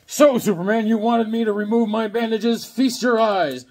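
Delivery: loud, high villain taunt; booming comic-book theatrics. Acted. Reads comic dialogue aloud dramatically.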